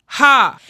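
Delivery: one loud, high "ha" burst; pretend laugh. Shown as a demonstration. Voice coach showing the stomach going out, pretend laugh. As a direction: punch out a single mock-laugh ha from the belly. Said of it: "pretend you were gonna laugh".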